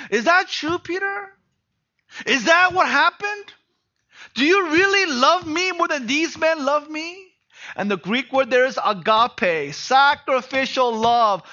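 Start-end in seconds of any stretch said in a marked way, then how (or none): 0.1-7.3 higher and louder, pressing the question; searching challenge. Retold or imitated. Jesus pressing Peter about his boast, loud and searching. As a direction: push Peter to face his own words.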